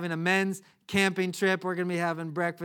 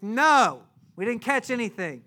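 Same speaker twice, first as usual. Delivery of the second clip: raised, higher answering shout; calling back; 6 semitones higher, 8 dB louder than usual. Acted. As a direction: holler the answer back over the water. Fishermen yell back to shore.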